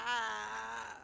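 short loud exasperated shout; fed-up outburst; frustrated yell. Real, not acted. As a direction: blurt out frustration at the workload. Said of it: "you sound like this when you're doing that".

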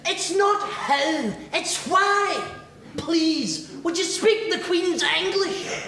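a lighter, slow posh lady; mildly mock the snob's correction; posh snooty customer. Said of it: "talk with this posh accent".

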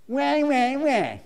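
high mock whimper; mock whining wah. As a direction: whine it out like a sulking child. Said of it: "Let me give it to you in the Greek".

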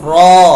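รอ said thick and heavy, punched loud. Shown as a demonstration. Teacher demonstrates the thick letter รอ loudly. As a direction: hit รอ full and heavy from the back. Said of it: "อ่านหนา".

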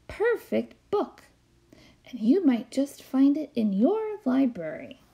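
gentle read-aloud storytime voice; story narration. A narrator's reading. Librarian reading a picture book to kids, storytime narration.

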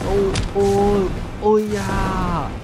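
long drawn-out startled oh-oh cry, slow and loud. Real, not acted. Gamer cries out in slow loud shock at the laser blast. Sudden fright during gameplay.

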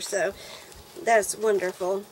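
normal talking voice

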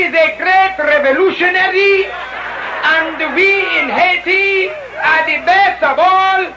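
imitated Haitian accent, boastful and drawn out; French Caribbean accent. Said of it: "from Haiti".

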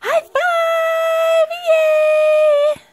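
high, loud squealed cheer. Real, not acted. Mother cheering baby's high five, loud high squeal.

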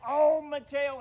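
loud, high hammed-up cry; yelling in pain. Bear cries over his torn tail, yelling in pain. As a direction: yell it out as a wounded wail. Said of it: "He was yelling and screaming".

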